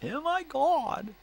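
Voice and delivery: very high, slow, stretched Oh my God; drawn-out shriek. Shown as a demonstration. Redoing the party trick for the listener, slow high squeal. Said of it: "I do it better when I'm drunk".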